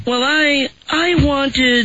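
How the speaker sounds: high whiny woman voice; Amber Fry voice. Acted. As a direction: whine it out like a clingy girlfriend. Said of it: "way too good at the whiny woman voice".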